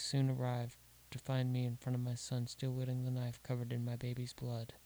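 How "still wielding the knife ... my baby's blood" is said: usual speaking voice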